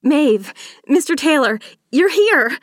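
high, loud delighted greeting; excited cry. A narrator's reading. Charlotte ushers her guests inside, excited cry. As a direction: cry out a welcome to arriving guests. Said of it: "Charlotte cried".

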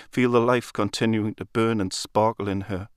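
ordinary delivery

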